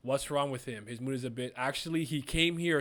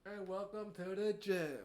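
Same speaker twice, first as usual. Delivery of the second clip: mock announcer; 4 semitones higher, 7 dB quieter than usual. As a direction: welcome them to the beatdown. Announcer joke over fight.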